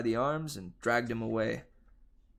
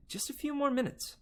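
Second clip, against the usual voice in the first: whiny plea. Acted. Girl begging to keep playing.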